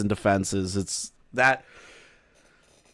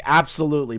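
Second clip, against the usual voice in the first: ordinary talk, nothing marked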